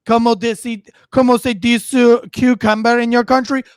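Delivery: loud mock Spanish accent; put-on Spanish accent, mocking a pretender; Hilaria Baldwin. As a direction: exaggerate a fake Spanish accent. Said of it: "You're being like Hilaria Baldwin".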